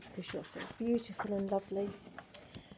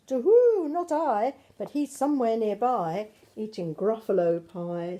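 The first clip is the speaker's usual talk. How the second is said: owl voice; 7 dB louder than usual. Acted. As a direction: hoot the first words like an owl. Owl speaks, hooting.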